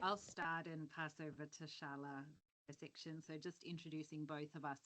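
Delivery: too quiet to hear; very soft, far from the mic. Real, not acted. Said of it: "You're really quiet".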